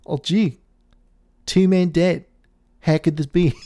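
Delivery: lightly voiced clueless local guy; mock a dim bystander's mild dismay; naive local dude. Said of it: "this random dude's perspective".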